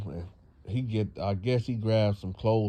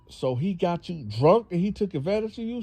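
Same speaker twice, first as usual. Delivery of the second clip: alarmed mother; 8 semitones higher than usual. Retold or imitated.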